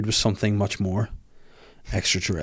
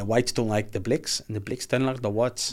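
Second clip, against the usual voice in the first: put-on South African accent; 9 dB quieter than usual. Puts on South African vowels.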